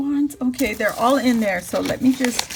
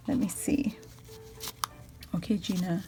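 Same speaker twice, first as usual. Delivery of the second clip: soft breathless whisper; 7 semitones lower, 12 dB quieter, faster than usual. Real, not acted. Peeking into the card's pocket, she whispers. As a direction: hush as you peek inside.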